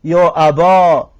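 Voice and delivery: «یا ابا» slow, ending on a long open "aa"; alif ending demo. Shown as a demonstration. Teacher modelling the alif form for students, slow. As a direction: hold the final "aa" of «یا ابا».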